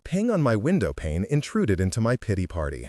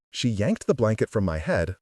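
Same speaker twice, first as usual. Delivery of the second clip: usual speaking voice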